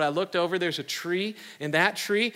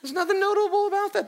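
much higher, fast scoff; mock scoffing; 13 semitones higher, 4 dB louder, faster than usual. Preacher joking with the church that gentleness is dull, high quick scoff. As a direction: toss it off as a joke, high and quick. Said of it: "mocking the ordinary fruit".

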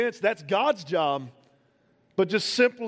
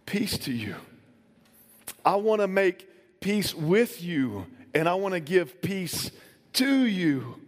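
tender; slower than usual. Acted. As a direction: reassure gently, like calming a frightened friend. Jesus speaks peace to sinner.

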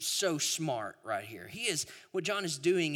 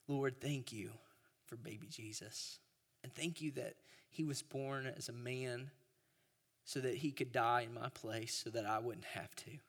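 put-on soft child prayer; 2 semitones lower, 9 dB quieter than usual. Child's sweet prayer retold.

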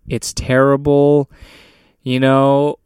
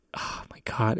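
breathy whispered disbelief; whispering; 2 semitones lower, 12 dB quieter than usual. Real, not acted. Disbelief aside.

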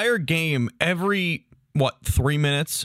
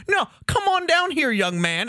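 a much higher, wheedling mock cop voice; mock cop coaxing; 11 semitones higher than usual. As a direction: coax him down like a smug small-town cop.

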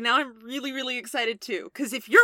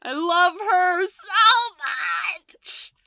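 slow loud drawn-out delight; gushing shout; 4 semitones higher, 5 dB louder, much slower than usual. Real, not acted. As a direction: shout the love out. Player gushing over character.

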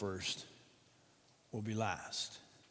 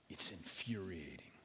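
whispered; 6 semitones lower, 11 dB quieter than usual. Acted. Whispered word.

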